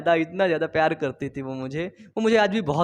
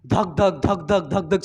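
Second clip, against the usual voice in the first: rhythmic dhak dhak heartbeat mimicry; heartbeat imitation. Shown as a demonstration. Teacher imitates a heartbeat for students, rhythmic dhak sounds. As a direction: tap out the heartbeat with your voice. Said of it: "hurt का sound क्या होता है?".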